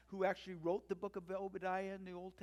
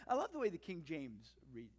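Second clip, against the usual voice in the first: ordinary talk, nothing marked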